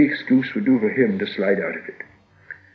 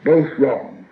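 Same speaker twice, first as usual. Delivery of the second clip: higher, louder outburst of relief; relieved cry; 4 semitones higher, 5 dB louder than usual. A narrator's reading. Bilbo relieved at Gollum's wrong guesses, crying out. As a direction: call it out, relieved to win. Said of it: "cried Bilbo, very much relieved".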